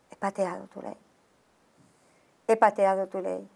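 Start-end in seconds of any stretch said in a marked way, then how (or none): 0.1-1.0 much softer, nearly whispered; whispered confession. Retold or imitated. Confessing to God in a whisper, ashamed. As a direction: confess it under your breath.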